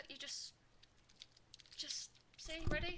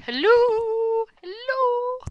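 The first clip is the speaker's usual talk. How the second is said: high, loud drawn-out hello into the mic; loud calling out; 15 dB louder, much slower than usual. Real, not acted. To viewers, checking the dodgy mic, a loud high call. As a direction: call out to be heard over a bad microphone.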